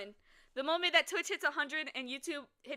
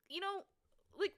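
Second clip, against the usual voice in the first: ordinary talk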